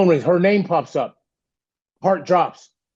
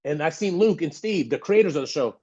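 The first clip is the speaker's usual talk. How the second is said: normal talking voice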